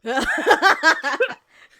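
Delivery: loud, high belly laugh; real burst of laughter. Real, not acted. Reacts to the disgusted aside.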